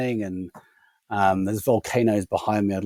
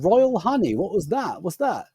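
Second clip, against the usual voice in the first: higher-pitched retold bafflement; baffled query; 7 semitones higher than usual. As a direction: echo it back, incredulous and high. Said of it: "And I said".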